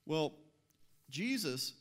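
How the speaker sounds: ordinary delivery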